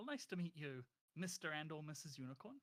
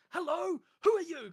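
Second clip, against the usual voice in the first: much higher and louder friendly shout; top of his lungs; 12 semitones higher, 15 dB louder than usual. Acted. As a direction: belt it out like a cheerful giant. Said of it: "shouting this at the top of his lungs".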